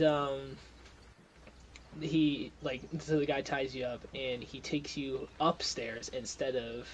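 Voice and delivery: ordinary talk, nothing marked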